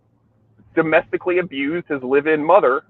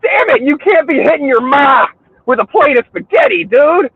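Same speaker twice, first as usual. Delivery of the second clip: high, loud exasperated yelling; hammed-up outraged neighbor; mock Italian outrage; 11 semitones higher, 4 dB louder, slower than usual. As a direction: shout it high like a fed-up Italian guy. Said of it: "And I'm like".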